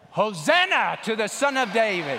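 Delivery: loud, high crowd cheer; shouting. Shown as a demonstration. Pastor showing kids how the Palm Sunday crowd shouted, full shout. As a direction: belt it out as a rallying shout. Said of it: "So this is shouting".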